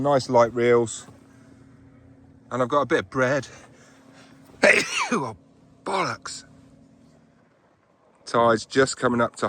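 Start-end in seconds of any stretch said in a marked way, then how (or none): 4.6-5.1 loud sudden sneeze; sneezing. Real, not acted. Sneeze fit, then swearing.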